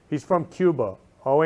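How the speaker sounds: usual speaking voice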